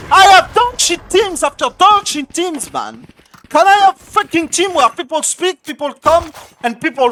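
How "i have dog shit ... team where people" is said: angry. Real, not acted. Raging at teammates mid-match.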